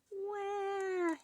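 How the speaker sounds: put-on very high, long drawn baby wail. A narrator's reading. Baby ghost on the step wants feeding, high wail. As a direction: cry like a newborn. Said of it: "yelled Baby Ghost".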